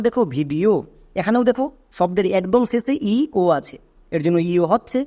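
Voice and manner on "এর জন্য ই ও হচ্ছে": ordinary talk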